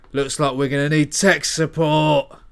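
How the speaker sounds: loud, confident one-liner; action one-liner. Acted. Hero sizing up fifty incoming men. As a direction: deliver it like a movie line. Character quip.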